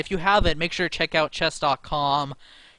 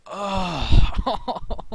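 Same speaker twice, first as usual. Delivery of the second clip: mock cry; 3 semitones higher, 6 dB louder than usual. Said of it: "I wanna cry".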